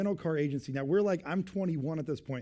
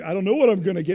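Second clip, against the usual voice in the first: much louder whiny indecision; dithering customer; 3 semitones higher, 10 dB louder, faster than usual. Retold or imitated. Imitating a drive-thru customer who can't decide, whiny.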